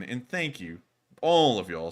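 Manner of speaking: normal talking voice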